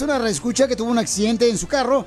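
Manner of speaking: high-pitched, punchy commercial pitch; radio ad read. Acted. Radio host pitches injury lawyers to listeners, high-energy ad read. Piolín reads a lawyer advertisement.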